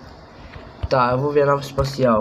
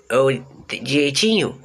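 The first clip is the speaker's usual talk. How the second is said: normal talking voice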